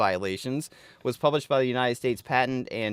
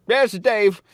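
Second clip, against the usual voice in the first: answering the phone as Dave, a touch louder; cheery pickup of a work call; mock phone greeting; 8 semitones higher, 8 dB louder than usual. Answering a client call as Dave, loud and chipper. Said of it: "answer the phone like".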